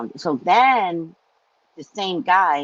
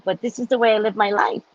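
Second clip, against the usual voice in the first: usual speaking voice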